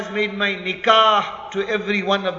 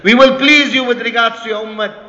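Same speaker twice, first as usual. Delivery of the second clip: raised, firm promise; emphatic assurance; 4 semitones higher, 6 dB louder than usual. Acted. Allah reassuring the Nabi about his ummah, emphatically. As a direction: press the promise home.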